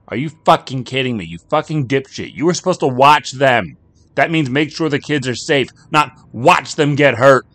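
high, loud furious screaming at her husband; furious yelling. Acted. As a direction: tear into him for not watching the kids. Wife screaming at husband in car.

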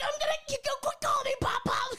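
high-pitched mock shouting; retold quarrel outburst. Host acting out a character's quarrel over Pop Pop, high mock outburst. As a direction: squawk it out in mock exasperation.

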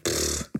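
blown-out lip puff, no voice; dismissive pfft. Retold or imitated. Performer showing nerves no longer matter, dismissive puff. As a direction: puff it away like nothing. Imitates shrugging off fear.

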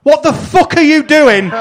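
high, loud incredulous shout; exasperated disbelief. Acted. Straight-man wrestler to his silly partner, exasperated disbelief. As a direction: yell it in comic outrage. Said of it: "Thatcher is going".

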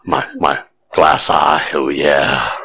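low, slow creepy come-on; creepy purr. Pretend. Creepy glass eye come-on.

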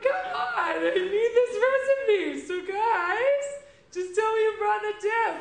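desperate pleading. Acted. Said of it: "this first character".

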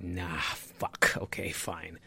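soft unvoiced grumble, then giving in; grumbling whisper. Host grudgingly agreeing to a nine AM meeting, whispered. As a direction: sulk under your breath. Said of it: "I would be like".

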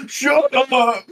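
high sulky shout; troll snapping. Acted. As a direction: snap at them to shut up. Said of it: "He's like".

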